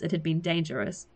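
usual speaking voice

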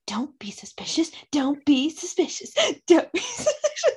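hushed sing-song sneaking chant; playful mock-sneaky whisper; creeping suspicious whisper. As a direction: whisper it like someone tiptoeing guiltily. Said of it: "this sudden vision of him, like, creeping around".

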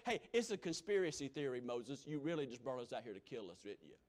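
low, fast sarcastic jab; mock the grumbling people; sarcastic crowd. Mocking complaining crowd.

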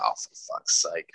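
low, slow, breathy grumble; a deflated, muttered curse; exasperated. Retold or imitated. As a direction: sink into defeat under your breath. Finding out bottles are pink.

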